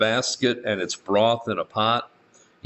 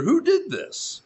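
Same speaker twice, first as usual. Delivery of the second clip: high, shocked outcry; mildly acted alarm of the townsmen; startled question; slower than usual. Townsmen discovering the wrecked altar.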